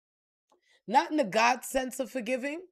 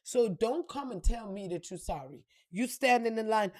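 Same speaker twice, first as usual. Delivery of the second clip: normal talking voice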